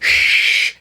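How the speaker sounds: sharp whispered shush; mock hushing from the other parents. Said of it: "They're like".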